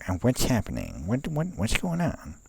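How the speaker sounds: high, dazed voice for the computer. Pretend. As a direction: play the frozen computer, lost and bewildered. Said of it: "being like".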